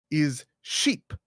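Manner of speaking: Spanish accent, "sheep" with a short tight i like Spanish "sheep". Shown as a demonstration. Explaining Mateo's Spanish accent, "sheep" said Spanish-style. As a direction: squeeze "sheep" into the Spanish i vowel. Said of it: "if I'm Mateo".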